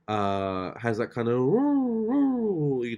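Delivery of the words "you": a high, drawn-out howl like a sad dog; dog's sad drawl. Retold or imitated. Imitating her moping dog's sad, high howl. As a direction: stretch it into a mournful dog whine. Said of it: "that kind of sad drawl".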